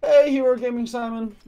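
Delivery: delighted shout of welcome; excited greeting. Real, not acted. Greeting a returning chat friend.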